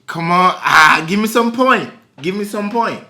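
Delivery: ordinary talk, nothing marked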